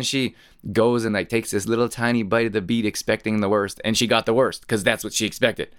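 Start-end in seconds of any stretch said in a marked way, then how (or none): none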